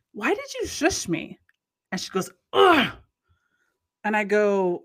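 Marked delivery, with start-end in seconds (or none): 0.1-1.4 indignant question. Retold or imitated. Confronting the lady. 2.5-2.9 loud, high scornful "ugh"; disgusted scoff. Retold or imitated. Lady scoffs at her.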